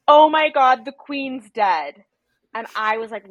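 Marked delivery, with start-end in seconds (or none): 0.1-2.0 mock shocked cry, the last words slowed; retold scream. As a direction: burst out with the news, then drag it out. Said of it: "just screams and says".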